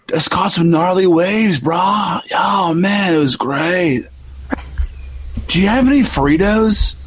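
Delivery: high, slow surfer-stoner drawl. Pretend. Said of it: "that stoner voice".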